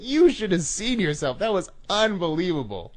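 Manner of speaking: high, loud crowing over a prank; imitating delighted hilarity. Prankster revels in his victim's reaction, high and gleeful. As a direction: crow with delight at their reaction. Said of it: "He started laughing so hard".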